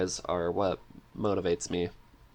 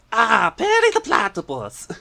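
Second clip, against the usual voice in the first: much higher and much louder cartoon villain voice; Doofenshmirtz impression; 11 dB louder than usual. Guest performs for the host on request, loud high villain voice. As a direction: belt it out like a scheming cartoon scientist caught mid-plan. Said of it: "I'm curious about the Doofenshmirtz now".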